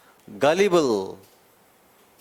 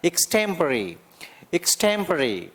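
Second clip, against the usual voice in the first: एक्सटेम्परी: a short ik, a raised STEM, a short pari; stress on STEM. Shown as a demonstration. Teacher repeats एक्सटेम्परी with stress on STEM. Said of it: "एक को छोटा बोलेंगे, stem को उठाएंगे और परी को छोटा".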